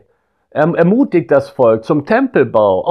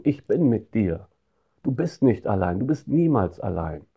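much softer and lower, tender and intimate; hushed comfort; 3 semitones lower, 7 dB quieter than usual. Acted. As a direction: say it like a hand on the shoulder. God's promise spoken to the listener.

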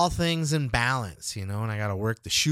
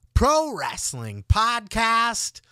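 high, slow, booming announcer stretch of the show name; announcer sign-off; 11 semitones higher, 7 dB louder, much slower than usual. Acted. Host closing his wrestling podcast, ring-announcer boom. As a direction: belt out the title like a ring announcer.

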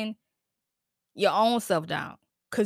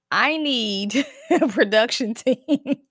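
laughing; 2 semitones higher, 5 dB louder, much slower than usual. Real, not acted. Laughing at her tech trouble.

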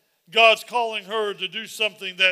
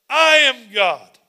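slow and loud, every word weighed; slow loud declaration; 3 semitones higher, 11 dB louder, much slower than usual. Acted. Pastor repeating God's words to the church, slow and loud. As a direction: proclaim it with full authority.

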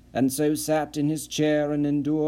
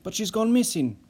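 higher, rising alarm; alarmed Nissen; 5 semitones higher than usual. Acted. Nissen alarmed, telling Perry she's missing. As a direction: press the news urgently.

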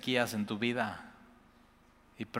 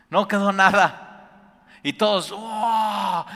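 excited fire narration; 8 semitones higher, 11 dB louder than usual. A narrator's reading. Pastor telling the church how fire consumed everything, loud excited awe. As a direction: shout it as if the fire falls before you. Preacher acting out fire from heaven.

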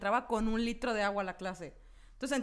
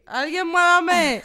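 slow, drawn-out, louder mock plea; pleading; 5 semitones higher, 10 dB louder, much slower than usual. Stuck in a nap, begging someone to move her, slow mock plea. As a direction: drag it out like a helpless sleeper.

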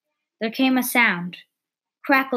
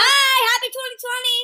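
squeaky high cheer; faster than usual. Real, not acted.

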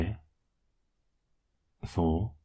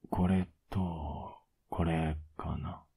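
slow soft mutter; half-whispered, talking to himself; 7 dB quieter, much slower than usual. Acted. Sorting laundry, muttering to self.

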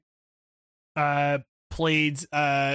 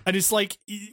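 normal talking voice

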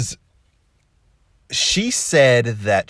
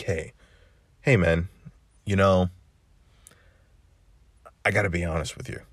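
lower, earnest heart-to-heart voice; a lower, sincere man-to-man tone; serious tone; 5 semitones lower, 5 dB quieter than usual. Shown as a demonstration. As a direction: drop the pitch and level with him straight. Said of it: "I'm gonna sound a little bit more like this".

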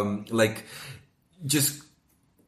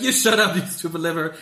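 mock scolding, a bit higher; Homer scolding; 7 semitones higher than usual. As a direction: tell off the liver as Homer.

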